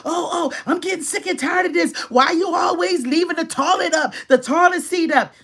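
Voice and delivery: hammed-up nagging about the toilet seat; wrong way, heated. Shown as a demonstration. A heated partner griping at the household, mock anger. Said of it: "that is the wrong way to do it".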